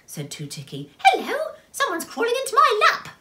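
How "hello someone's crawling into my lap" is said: high, loud startled little character; surprised. A narrator's reading. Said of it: "said Little My surprisedly".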